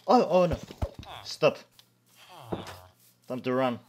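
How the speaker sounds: loud, high panicked yelling. Real, not acted. Player shouting in panic as monsters chase him. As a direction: yell in panic while fleeing.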